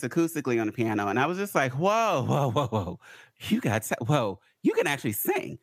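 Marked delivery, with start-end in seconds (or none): none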